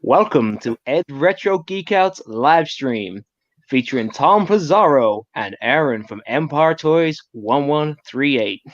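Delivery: put-on slow, drawn-out American accent. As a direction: put on a game American accent for the live stream intro. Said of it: "do your best American accent".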